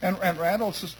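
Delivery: ordinary talk, nothing marked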